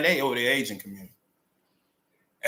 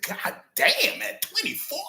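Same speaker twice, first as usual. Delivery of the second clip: stunned disbelief; 13 semitones higher than usual. Retold or imitated. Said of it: "I said".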